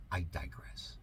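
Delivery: dropped to a whisper; whispered aside. Acted. Host reading article steps aside in a whisper.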